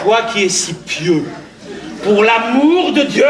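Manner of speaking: exasperated plea. Acted. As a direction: cry it out at the end of your patience. Excédé par l'intrus. (supplication excédée, forte et traînante)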